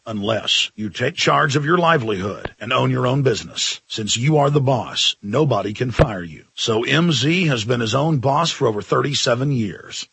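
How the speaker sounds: ad read. Acted. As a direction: read the sponsor spot evenly.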